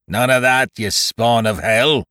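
angry pirate roar, drawn out on the insult. A narrator's reading. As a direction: bellow it like a furious captain. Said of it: "he roared".

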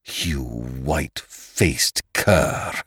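slow snarl through clenched teeth. A narrator's reading. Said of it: "he snarled through clenched teeth".

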